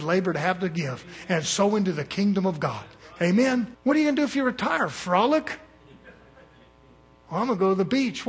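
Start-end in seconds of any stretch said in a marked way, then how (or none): 3.8-5.6 higher, rushed teasing question; mock questioner. Preacher mocking someone who asks about retirement, fast and high. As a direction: fire it off fast and high like a nosy needler.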